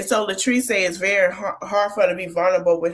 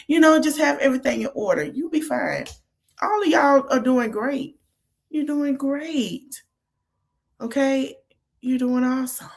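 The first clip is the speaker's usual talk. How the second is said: reassuring; 6 semitones higher than usual. Real, not acted. Commentator reassuring the new parents on screen, high and gentle. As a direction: soothe them, tell them they're fine.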